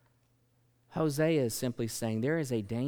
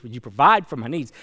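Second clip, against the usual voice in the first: louder plea; 11 dB louder than usual. Retold or imitated. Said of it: "cries of missionaries around the world saying".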